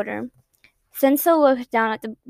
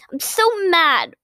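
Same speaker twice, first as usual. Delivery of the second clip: higher, slowed outburst of anger; angry cry; 6 semitones higher, 3 dB louder, slower than usual. A narrator's reading. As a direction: cry it out, seething. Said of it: "Ochako cried".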